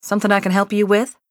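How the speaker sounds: calling across the yard, quick and lifted. A narrator's reading. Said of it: "called out".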